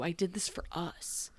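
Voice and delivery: soft unvoiced whisper. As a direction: breathe the line out in a whisper.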